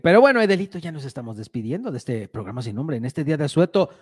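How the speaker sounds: ordinary talk, nothing marked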